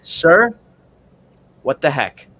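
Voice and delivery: hammed-up confrontation through the window; mock telling-off. At the red light, scolding the other driver, mock stern. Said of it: "and I said".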